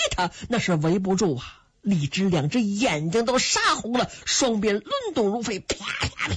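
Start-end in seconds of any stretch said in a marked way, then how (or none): 5.6-6.3 voiceless cracking whip noises; rapid mouth-made impact sounds; imitated whip blows. A narrator's reading. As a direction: make the smacks of flying whips. Imitates whips striking enemy soldiers.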